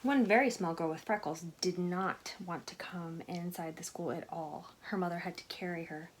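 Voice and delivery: ordinary delivery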